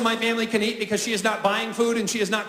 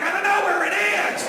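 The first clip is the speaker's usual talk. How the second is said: frantic husband; 10 semitones higher than usual. Acted. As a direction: yell back in a helpless panic. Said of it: "I'm like".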